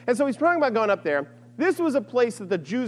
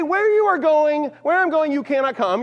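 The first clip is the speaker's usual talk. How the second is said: exasperated mimicking; 6 semitones higher, 4 dB louder than usual. Acted circular exchange with Jesus.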